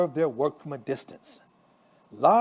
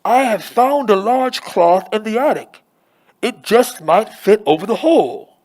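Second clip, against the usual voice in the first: loud, high, muffled calling; muffled shout; 6 semitones higher, 11 dB louder than usual. A narrator's reading. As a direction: shout it down from the attic. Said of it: "Anna shouts in a muffled voice".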